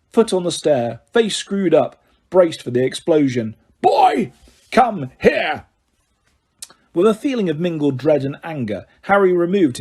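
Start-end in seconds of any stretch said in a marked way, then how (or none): 3.8-5.6 much higher, barked summons; angry command. Acted. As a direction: bark an angry order. Vernon summoning.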